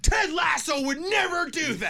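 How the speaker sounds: high, loud mock-outraged fan squeal. Imitating fans shocked at the custody news, high mock outrage. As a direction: shriek it like a scandalized fan who can't believe it. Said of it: "people were like".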